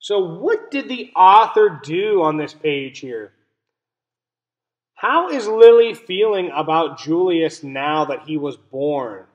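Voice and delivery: usual speaking voice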